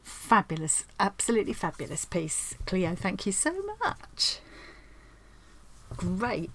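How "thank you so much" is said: heartfelt thanks. Real, not acted.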